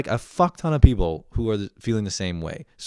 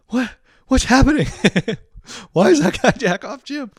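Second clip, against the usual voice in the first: very high, squealed disbelief; comic freak-out at what you're witnessing; panicked bewilderment; 13 semitones higher, 4 dB louder than usual. Retold or imitated. Freshman watching Jim get renamed, panicked bewilderment.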